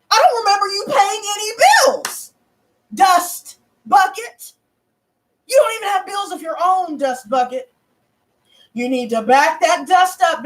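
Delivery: high, scornful reenacted takedown; putting foot down. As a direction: scold at a high pitch, stretching the insult. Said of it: "the foot down like that".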